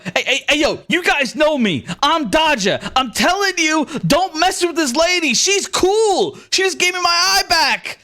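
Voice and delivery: high-pitched, pleading street tough; worked-up bandit vouching for a stranger; excited bandit. Acted. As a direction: plead with your gang in a high, urgent voice. Dodger pleading with fellow bandits.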